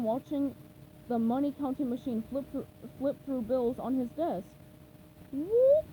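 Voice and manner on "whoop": loud high whoop of joy; delighted whoop. A narrator's reading. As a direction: cheer at the money. Krabs delighted.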